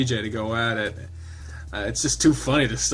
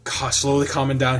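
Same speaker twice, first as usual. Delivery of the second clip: usual speaking voice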